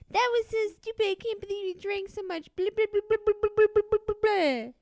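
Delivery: mock a nagging husband scolding. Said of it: "being like".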